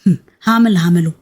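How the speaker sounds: a touch of mockery, fast; mild sarcasm. A narrator's reading. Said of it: "ابتسم عمر بسخرية".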